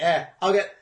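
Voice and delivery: high, loud breezy overconfidence; mocking drunk logic. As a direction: mimic a careless plan, upbeat. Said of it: "the logic that you were like".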